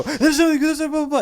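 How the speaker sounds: high, loud frantic babble; panicked babbling. Retold or imitated. Naked teen babbling to his brother, high and frantic. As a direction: blurt it out in a panic. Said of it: "and I go".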